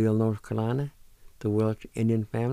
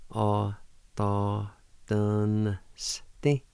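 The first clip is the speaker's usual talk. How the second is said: "A, ta, dun, sti" with gaps, rising on "sti"; syllable-by-syllable pronunciation; much slower than usual. Shown as a demonstration. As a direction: hold each syllable apart. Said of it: "like this".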